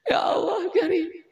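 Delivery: high crying wail of a betrayed husband; mock wailing. Preacher mock-wails as the abandoned husband. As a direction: sob it out in mock grief.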